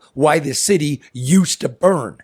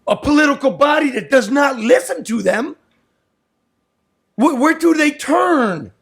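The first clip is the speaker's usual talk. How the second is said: heated rant; 9 semitones higher than usual. Real, not acted. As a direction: let the frustration boil over. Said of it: "my fucking rant".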